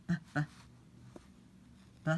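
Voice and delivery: short voiced b pops; teacher sounding out "b"; phonics sound. Shown as a demonstration. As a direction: say "b" as a bare pop. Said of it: "we need a b".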